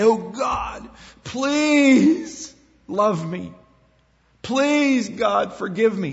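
high, slow begging plea to God; pleading prayer. Pretend. Worshipper begging God for love, pleading high and slow. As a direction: beg like a desperate petitioner. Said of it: "We don't need to say".

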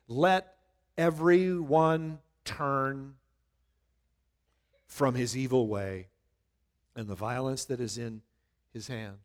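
solemn slow reading fading out; slow emphatic reading. Shown as a demonstration. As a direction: let each phrase land, fading. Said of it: "last line of that statement".

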